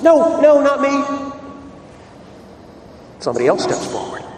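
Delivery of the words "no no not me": high, loud, frantic no, no; panicked denial. Acted. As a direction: backpedal in alarm, voice jumping up. Peter denies, recoiling from accuser.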